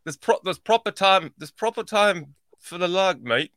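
deliberate Yorkshire "proper time", slowing down; imitated Yorkshire accent. Host practises co-host's accent, careful Yorkshire attempt. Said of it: "That's it. ... You getting it".

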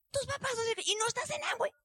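high, disbelieving questions; incredulous acquaintance. Retold or imitated. Acquaintance learning who her parents are, high and incredulous. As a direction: sound shocked she isn't in the business.

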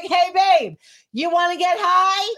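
high, loud, drawn-out invite; upfront invitation. Shown as a demonstration. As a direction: demonstrate an eager, unmistakable offer. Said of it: "Come out and be like".

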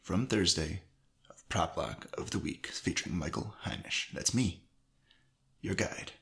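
recorded down in an echoey basement. Real, not acted. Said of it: "you can tell it sounds different".

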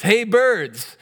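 loud, high-pitched holler to the birds; calling out. Acted. Frog goes outside to summon the birds, calling out loudly. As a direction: call out as if across a yard.

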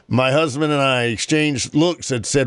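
usual speaking voice

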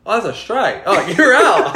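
high, loud umpire call through laughter; mock umpire. Retelling the umpire calling him out, laughing. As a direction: imitate the shocked umpire laughing. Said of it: "he goes".

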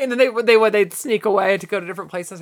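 ordinary talk

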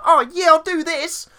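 squeaky, very high and loud mock voice; high-pitched voice. Mocking Paul Feig to Bill Murray in a high-pitched voice. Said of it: "High-pitched voice".

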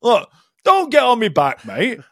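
grumpy barman. Pretend. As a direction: grumble like a barman who wants to be left alone. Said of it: "and you say".

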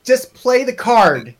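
loud, high, drawn-out exasperated pleading; fed up, beg a slow opponent to get on with it; exasperated plea. Pretend. Imagined exasperated reply to opponent.

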